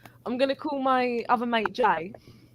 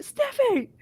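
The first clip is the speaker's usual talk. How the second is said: calling out. Retold or imitated. Group calling injured mate.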